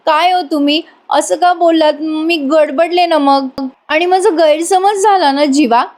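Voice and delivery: imitating an irritated, whining wife; mildly annoyed. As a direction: sulk like a peeved wife. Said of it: "Nandini चिडून म्हणते".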